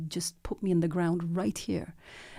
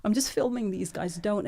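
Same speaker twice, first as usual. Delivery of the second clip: pleading; 5 semitones higher than usual. Retold or imitated. Filmmaker pleading with protesters, higher and slowed. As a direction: beg them not to spray you, voice lifted high.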